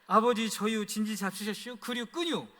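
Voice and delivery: short, drawled Chungcheong country dialect, 저유 and 잡수셨슈 with the -유 endings. Shown as a demonstration. Son phoning his father in Chungcheong dialect. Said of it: "이 네 마디만 해도".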